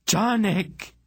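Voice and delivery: very high, drawn-out cry; shriek. A narrator's reading. Aunt hears the detonation beside the smith, shrieking. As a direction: shriek the name far above normal pitch. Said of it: "Aunt Paul shrieked".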